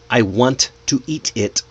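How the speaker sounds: each word of "I want to eat it" separate; unlinked, word by word, every t pronounced; robotic. Shown as a demonstration. Teacher shows what to avoid, robotic word-by-word. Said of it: "See how robotic that sounds?".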